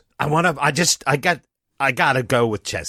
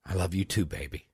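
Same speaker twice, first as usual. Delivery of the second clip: much softer, lower, tender reply; tender whisper; 6 semitones lower, 11 dB quieter than usual. Acted. Father answers his daughter's hug, softly and tenderly. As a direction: hush it out lovingly.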